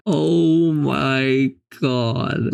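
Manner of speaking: very slow, drawn-out groan of disbelief; stunned dismay. Real, not acted. Player seeing his worst score ever, stunned dismay. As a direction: stretch every word in dismay.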